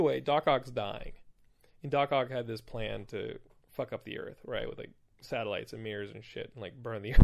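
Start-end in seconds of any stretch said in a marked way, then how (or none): none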